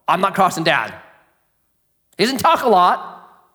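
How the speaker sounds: emphatic preaching. Real, not acted. Preacher telling dads to have bite over bark, emphatic preaching. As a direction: press the point on the fathers.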